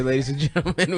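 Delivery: ordinary delivery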